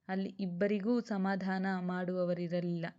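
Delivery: ordinary delivery, nothing marked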